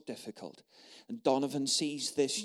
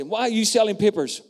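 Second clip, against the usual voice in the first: calling out; 8 semitones higher, 9 dB louder than usual. Retold or imitated. Donovan calls to the young paper seller.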